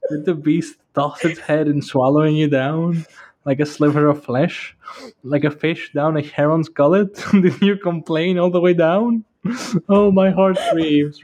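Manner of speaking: reading while giggling, voice climbing; can't keep a straight face; breaking character giggling. Real, not acted. Reading aloud, laughing through it.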